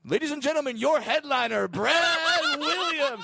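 high, loud headliner introduction; big announcer build, name drawn out and shouted; stage announcer. Retold or imitated. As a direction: belt it out like a comedy club emcee. Imitates club announcer introducing him on stage.

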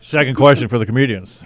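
low, loud broadcaster; Tom Brokaw. Acted. Comic putting a question to comedians, mock broadcaster voice. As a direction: announce it like a news anchor. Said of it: "using his Tom Brokaw voice here".

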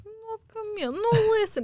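high-pitched imitation of a Russian coach; Russian accent. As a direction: insist like the Russian coach, high and loud at the end. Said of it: "imitate his accent all the time".

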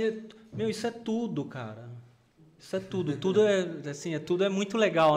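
usual speaking voice

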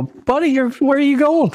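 high-pitched, eager hailing of a buddy; excited mock greeting. Hailing a fellow Canadian abroad, excited.

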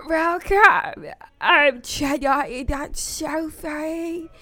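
slow, airy indie host intro; sweet indie podcast welcome; indie girl voice. Pretend. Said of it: "It's my indie girl voice".

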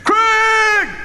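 high, full-voiced cheer from the stands; imitated father's drawn-out, high-pitched shout; loud sideline yell. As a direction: holler it like a dad over a roaring crowd. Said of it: "he would be loud".

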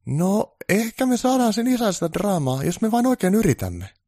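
eager to please; keen assistant voice; eager helper. Acted. Onni offers hope.

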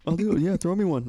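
lightly high, keen dude asking for a spot; eager comic. Retold or imitated. Comic playing a stand-up asking to be put up, mildly high. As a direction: toss it off like a comic begging a spot.

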